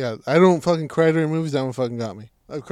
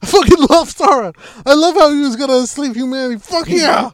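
loud, high hammed-up glee; gleeful, shouting praise for the dark lord; mock villain fan; 10 semitones higher, 9 dB louder than usual. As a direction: cheer for the villain like a cartoon fan. Playing evil fan, exaggerated.

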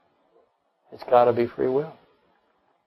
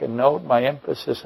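ordinary talk

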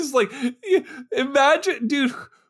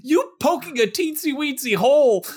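higher, worked-up protest; incredulous; 8 semitones higher than usual. Real, not acted. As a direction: push back in disbelief. Protesting the teensy hole.

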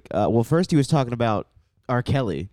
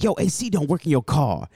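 raised hip hop commercial pitch; imitated radio ad; 6 semitones higher than usual. Host quotes an insane radio ad, mock pitchman voice. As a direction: sell it like an over-the-top car ad. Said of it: "the one it's like".

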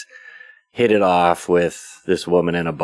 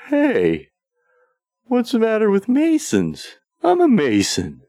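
much higher, offended yelp of surprise; indignant protest. Retold or imitated. Michael protesting to the old man, sudden indignant high pitch. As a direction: play Michael suddenly taking it personally.

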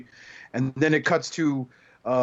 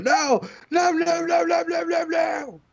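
Shia LaBeouf panicking. Retold or imitated. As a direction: squeal the no's in rising panic like Shia LaBeouf. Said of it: "Shia LaBeouf was the other one going".

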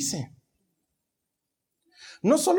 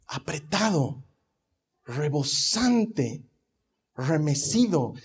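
slow emphatic; 4 semitones lower, 3 dB quieter, much slower than usual. Acted. Preacher savours the scripture words.

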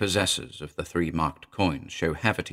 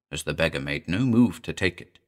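ordinary delivery

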